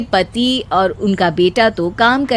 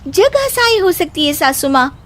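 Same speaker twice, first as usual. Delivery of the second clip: put-on higher young bride voice, patiently scolding; higher daughter-in-law warning of ridicule; daughter-in-law, reasoning; 8 semitones higher than usual. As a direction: warn her mother-in-law kindly.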